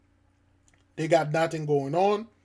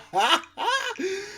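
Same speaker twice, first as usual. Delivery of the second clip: short laugh pitched up high; high disbelieving laugh. Real, not acted. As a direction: laugh at the absurdity. Laugh after really bad.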